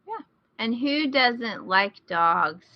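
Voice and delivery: usual speaking voice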